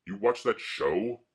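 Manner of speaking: mildly hammed-up incredulous question; slow, rising mock question; mocking classmates. Imitating schoolmates scoffing at the X-Men movie, mocking question. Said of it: "And they'd say".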